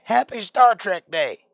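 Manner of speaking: loud, high-pitched hammed-up cheer; exclaimed greeting. Host wishing viewers happy Star Trek Day, exclaimed. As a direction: call it out big and high.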